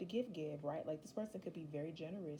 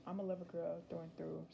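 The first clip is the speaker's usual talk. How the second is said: ordinary delivery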